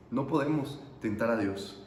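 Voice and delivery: low, soft, grave warning; subdued repeat. Real, not acted. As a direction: drop right down and let it sink in.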